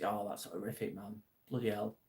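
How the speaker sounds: soft, low-key shocked sympathy; hushed dismay. Real, not acted. Streamer reacting to a viewer's terrible loss, hushed dismay. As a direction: let it sink in, quietly.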